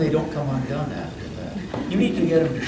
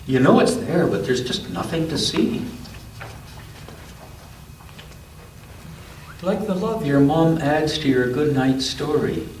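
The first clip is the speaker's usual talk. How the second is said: gentle storybook read-aloud; unhurried picture-book narration; reading to a child; 2 semitones higher than usual. A narrator's reading.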